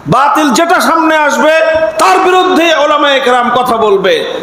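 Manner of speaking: fiery preaching. Acted. Preaching at full force.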